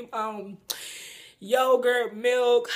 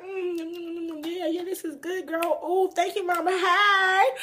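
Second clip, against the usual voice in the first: savoring; 5 semitones higher than usual. Retold or imitated. Savoring lasagna, thanking waitress.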